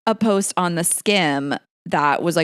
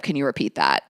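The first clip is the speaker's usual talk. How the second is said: deadpan ask; 7 semitones lower than usual. Shown as a demonstration. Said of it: "just straight up".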